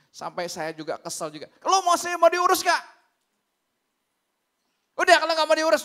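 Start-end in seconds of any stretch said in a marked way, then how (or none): 1.6-5.8 loud, high, exasperated shout; angry outburst. Retold or imitated. Pastor snapping at a caller on the phone, angry shouting. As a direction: re-enact losing your temper, yelling. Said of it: "jadi saya marah juga".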